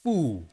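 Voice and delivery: "fu" modelled slowly and cleanly; Mandarin syllable. Shown as a demonstration. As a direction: model "fu" for repetition. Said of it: "Tu peux les prononcer en même temps que moi".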